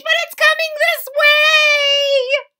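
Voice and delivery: very high, loud, slow scared voice; nervous leaf watching the dark; frightened. Acted. Leaves frightened by rumble.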